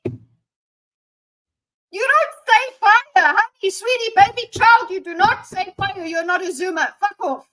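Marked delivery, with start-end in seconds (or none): 1.9-7.5 high, loud exasperated telling-off; mock scolding. Scolding Hassan for saying fire, high loud mock exasperation. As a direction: scold him like a fed-up mom.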